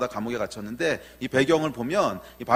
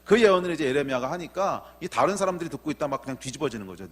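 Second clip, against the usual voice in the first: usual speaking voice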